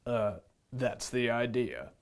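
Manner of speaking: put-on the lion answers the worried boy, low. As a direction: say it low and dry. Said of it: "said the lion".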